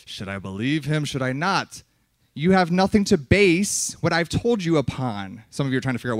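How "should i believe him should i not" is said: low, soft musing aside; doubting listener. Retold or imitated. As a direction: murmur it as a wavering listener. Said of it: "And you could say".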